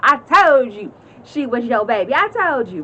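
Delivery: high, sing-song gloat; a high, teasing I-told-you-so. Retold or imitated. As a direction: rub it in, singing out the victory. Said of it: "y'all know I did my".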